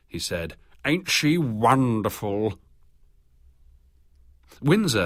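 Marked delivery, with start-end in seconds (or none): 0.8-2.6 slow, relishing leer; slow leering admiration after being told off. A narrator's reading. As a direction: savour each word slowly, leering. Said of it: "Williams leered".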